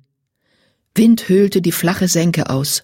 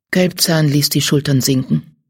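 plain reading voice

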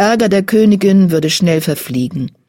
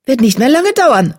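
announcing kitten; 8 semitones higher, 3 dB louder than usual. A narrator's reading. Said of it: "verkündete Disteljunges".

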